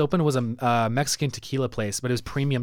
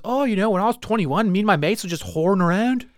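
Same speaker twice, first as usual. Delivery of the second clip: raised, mock British accent; 5 semitones higher than usual. Brit recalling his youth with mates, high mock British accent. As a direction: imitate the Brit's casual boast, pitched up. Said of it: "like, British accent".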